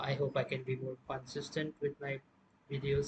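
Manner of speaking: ordinary talk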